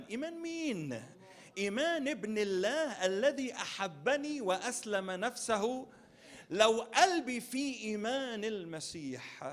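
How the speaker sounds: loud, high climax of a sermon; preaching at full force. Acted. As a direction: proclaim it to the congregation.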